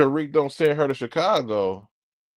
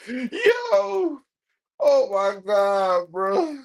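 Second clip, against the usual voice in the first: high squeal through real laughter; breathless laughing disbelief; 12 semitones higher, much slower than usual. Real, not acted. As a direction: crack up while getting the words out. Laughing hard at the show.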